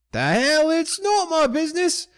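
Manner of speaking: very high, loud outrage; indignant high-pitched holler; Don John. Acted. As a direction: shout back in disbelief at the rats. Mine boss yells back.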